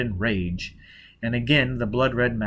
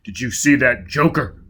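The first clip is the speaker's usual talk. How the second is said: demanding; 5 dB louder than usual. A narrator's reading. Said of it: "Foyle demanded".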